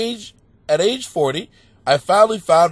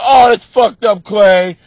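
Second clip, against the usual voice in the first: mock complaint; 3 semitones higher, 9 dB louder, slower than usual. Acts out people complaining to host.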